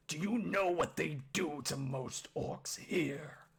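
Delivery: loud, gruff orc confronting his son; menacing orc father. Acted. Orc father challenges Oski, loud gruff menace. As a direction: growl it loudly, with heavy emphasis.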